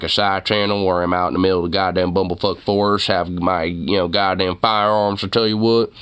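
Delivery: a hammed-up Texan good ol' boy ranting about guns; Southern cowboy accent. Streamer mocks how he'd run a side channel, in a Southern cowboy accent. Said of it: "nice principal cowboy southern voice".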